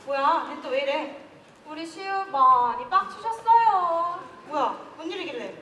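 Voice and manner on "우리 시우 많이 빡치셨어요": high, slow, mock-soothing tease; drawn-out playful ribbing; teasing coddling. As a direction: coo at a sulking friend like a child. Friend teasing the angry one.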